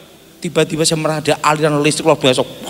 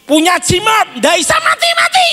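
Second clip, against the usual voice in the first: high, loud comic shout; shouting punchline; 3 dB louder than usual. Real, not acted. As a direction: yell the punchline. (berteriak lucu dengan nada tinggi)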